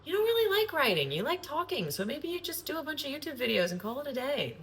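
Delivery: high, mock-scolding inner part; teasing inner voice. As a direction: tease herself in a high voice. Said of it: "another part of me that's like".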